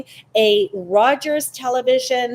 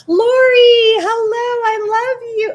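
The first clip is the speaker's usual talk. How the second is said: high, loud, gushing hello; delighted shriek of a greeting; excited squeal; 12 semitones higher, 9 dB louder than usual. Real, not acted. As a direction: squeal it out to a dear friend. Greets viewer Lori excitedly.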